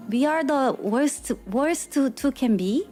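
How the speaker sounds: ordinary talk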